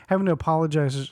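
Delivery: ordinary delivery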